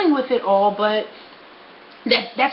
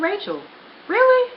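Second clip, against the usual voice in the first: incredulous; 12 semitones higher than usual. Retold or imitated. Scolding herself in memory.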